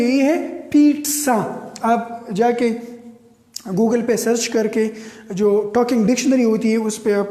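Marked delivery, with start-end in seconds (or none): 0.7-1.6 پیٹزا as slow "peet-sa"; correct pronunciation. Shown as a demonstration. Teacher corrects the phonetic reading, پیٹزا as "peet-sa", deliberate correction. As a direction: stretch پیٹزا out, sounding the "ts" clearly. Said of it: "تو یہ پیزا نہیں ہے".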